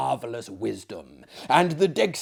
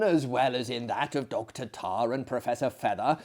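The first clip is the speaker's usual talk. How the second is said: ordinary delivery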